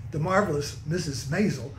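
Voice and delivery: ordinary talk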